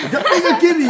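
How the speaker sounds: high, loud, laughing "who's kicking?"; startled outburst. Retold or imitated. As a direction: blurt it out half laughing. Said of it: "みたいな".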